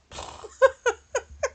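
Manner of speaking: bright burst of laughter. Real, not acted. Laughing at her own joke about Adam, burst of laughter.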